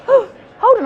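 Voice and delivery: jolted awake by the thought; sudden realization. Retold or imitated. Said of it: "because I was like".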